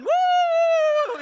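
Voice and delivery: put-on high, loud kid's whoop; excited whoop. Son on the couch whooping during towel fight, loud high whoop. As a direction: whoop like a thrilled kid. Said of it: "standing on the couch going".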